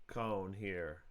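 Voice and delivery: ordinary delivery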